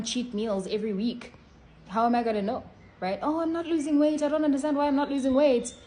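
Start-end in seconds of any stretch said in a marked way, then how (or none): 3.2-5.7 higher, louder complaining whine; mocking whine. Mocking a dieter confused about not losing weight, whiny. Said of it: "mocking dieters who cheat".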